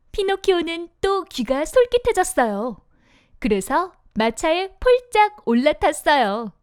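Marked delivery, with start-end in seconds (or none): none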